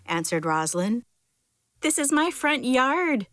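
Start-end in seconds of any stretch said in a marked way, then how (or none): 1.8-3.3 high-pitched indignant worm, mildly shouted; mild story shout. A narrator's reading. Worm shouts at the rabbit digging in his yard, mild shout. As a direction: shout it lightly, pitched well up. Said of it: "shouted the worm".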